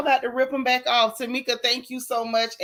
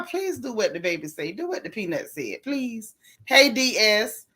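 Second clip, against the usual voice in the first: ordinary delivery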